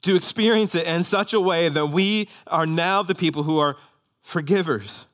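prayer. Real, not acted. Pastor prays over the congregation.

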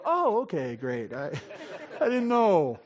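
mock sarcastic "oh okay, great"; a hammed-up sarcastic thanks; sarcastic mock reply. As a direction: sneer it back, laughing a little. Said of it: "they go".

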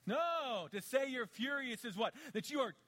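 rising sermon shout. Real, not acted. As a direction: drive it home with force. Preacher rises to full force.

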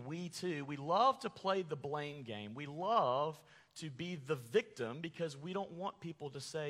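normal talking voice

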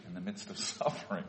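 real laugh slipping out mid-sentence; suppressed laughter. Real, not acted. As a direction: try not to laugh while speaking. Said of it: "I shouldn't be laughing".

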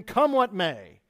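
ordinary delivery

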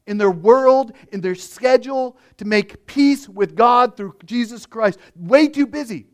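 rising fervent sermon delivery; preaching at full force. Acted. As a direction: drive the point home from the pulpit.

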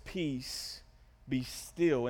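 low, soft, measured words; calm command. Acted. Jesus rebuking wind and waves, quiet calm command. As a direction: still the storm gently, with pauses. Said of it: "It says that he rebukes the wind and the waves".

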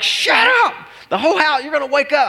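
high-pitched, yelped scolding; acted-out outburst at a screaming toddler; exasperated parent outburst. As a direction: yelp it like a frazzled dad. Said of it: "I wanna be like".

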